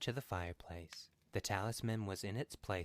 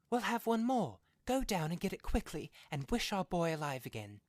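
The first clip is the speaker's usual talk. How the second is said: frantic; 11 semitones higher, 8 dB louder than usual. Acted. Frantic wife urges husband.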